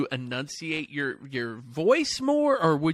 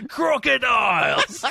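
loud, drawn-out Aussie shout; mock Australian accent; 6 semitones higher, 3 dB louder, much slower than usual. Host capping the wallabies joke, loud high mock Australian. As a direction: bellow it slowly like a comic Aussie.

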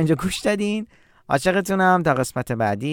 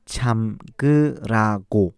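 slow; 6 semitones lower, much slower than usual. Shown as a demonstration.

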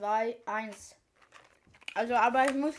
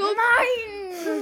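high laughing shriek; 9 semitones higher, 8 dB louder than usual. Real, not acted. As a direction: squeal no through laughter. Friend drew the Tabasco one.

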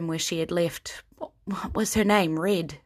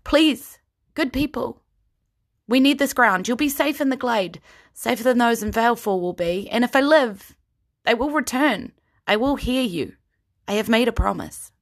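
higher, louder earnest appeal; rally the crowd with a promise; Kyra's plea; 6 semitones higher, 4 dB louder than usual. Acted.

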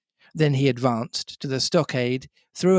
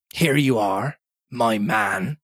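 lightly voiced haughty captain; stern captain; 3 semitones lower, slower than usual. A narrator's reading. Captain answering Silver, stern. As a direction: address him as an inferior.

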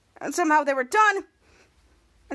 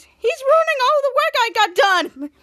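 high, loud, drawn-out complaint; distressed wail; 9 semitones higher, 5 dB louder than usual. Acted. Frank watching his face get slapped, loud wail. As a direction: wail it slowly in mock despair. Frank wails during slap fight.

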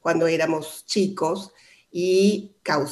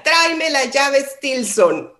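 slow, higher, louder imitation of a booming father; father's shout; 8 semitones higher, 6 dB louder, slower than usual. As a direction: call the order out like a stern dad. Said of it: "pegaba así un grito". (grito del papá, lento y más agudo)

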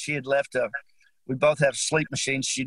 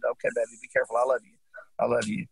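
tender goodbye; 4 semitones lower, faster than usual. Retold or imitated. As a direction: say it gently, holding back grief. Last goodbye to wife.

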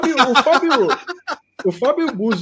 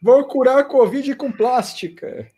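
mock plastic surgeon; 10 semitones higher than usual. Plastic surgeon voiced in a quote.

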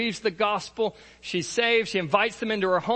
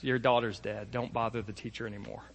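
hushed bad news; 7 semitones lower, 7 dB quieter than usual. Messengers bring bad news.

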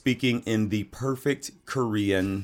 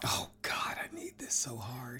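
whispered; 17 dB quieter than usual. Acted. Mock sigh before the show.

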